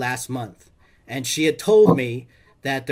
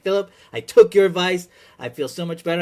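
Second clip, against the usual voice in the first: upbeat mom; 5 semitones higher, 5 dB louder than usual. Retold or imitated. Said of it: "totally upbeat".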